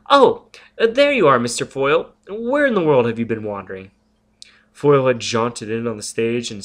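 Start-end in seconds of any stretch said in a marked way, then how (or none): 0.1-3.9 sudden high, loud burst of surprise; jumpy forced cheer; startled greeting. Acted. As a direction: caught off guard, covering it brightly. Robin startled, greets Foyle.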